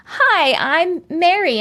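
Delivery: high, slow introduction; mock meet-and-greet voice; imitated new acquaintance. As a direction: play someone introducing themselves, drawn out. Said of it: "And they say".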